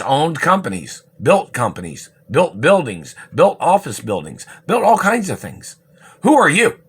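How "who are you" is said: shouted, higher, louder demand; angry shout. Real, not acted. Ranting at government people, an angry shouted challenge. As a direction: demand an answer, yelling.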